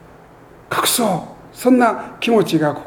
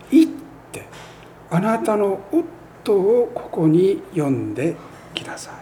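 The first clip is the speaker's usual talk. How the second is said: hushed command; 3 semitones higher, 4 dB quieter than usual. Acted. Jesus's words quoted softly.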